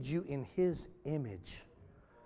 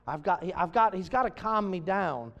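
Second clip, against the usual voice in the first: ordinary delivery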